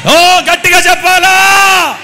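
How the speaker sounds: high, slow, very loud holler; rousing shout. Real, not acted. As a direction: whip the crowd into answering.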